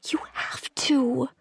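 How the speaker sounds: pleading whisper. A narrator's reading. Woman begging the captive, high pleading whisper. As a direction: whisper it urgently. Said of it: "I whispered".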